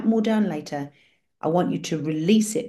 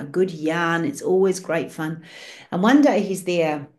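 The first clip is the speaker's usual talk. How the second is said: ordinary talk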